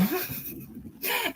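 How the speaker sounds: a high, airless giggle; breathy laugh. Real, not acted. Amused by her dog, a high breathless giggle.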